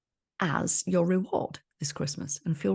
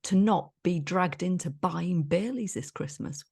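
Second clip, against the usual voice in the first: normal talking voice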